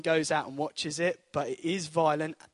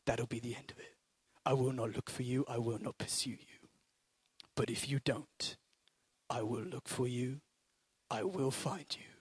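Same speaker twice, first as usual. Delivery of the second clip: much lower, softer, menacing phone threat; Liam Neeson; 5 semitones lower, 6 dB quieter than usual. Retold or imitated. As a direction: threaten the kidnapper in a hushed, deadly calm. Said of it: "I should have been an actor".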